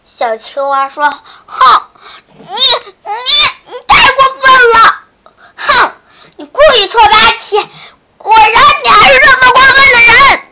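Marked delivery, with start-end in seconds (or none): 1.5-10.4 high, loud, fast furious shriek; angry shriek. Acted. Frog shrieking angrily at the shark who cheated. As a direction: scream your outrage at him. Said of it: "非常生气的在尖叫".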